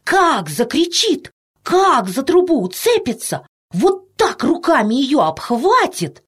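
put-on high, drawn-out excited boy voice; chattering boy. A narrator's reading. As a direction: draw out each exclamation like an amazed boy. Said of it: "говорил рыжий мальчишка". (мальчишка восторженно, нараспев)

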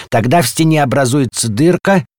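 warning. A narrator's reading. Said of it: "предупреждал дом". (предостерегающе, повышенным голосом)

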